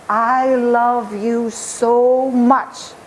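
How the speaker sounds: slow, tender reassurance; tender love. Acted. The maker's remembered words to the boy, slow and tender. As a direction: say it very slowly, like a gentle father.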